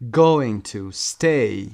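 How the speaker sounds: Going to stay very slow and loud, stay stressed. Shown as a demonstration. Teacher modelling the rhythm of going to stay. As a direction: drag it out and land on stay.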